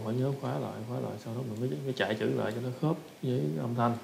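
ordinary talk, nothing marked

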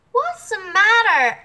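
high, loud questioning voices; concerned animals. Acted. The other animals ask the Sky God, high and loud.

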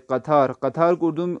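ordinary talk

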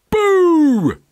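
loud, very high troll shout; troll's scare. A narrator's reading. Troll springs out at the friends, loud shout. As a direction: startle them with a sudden boo.

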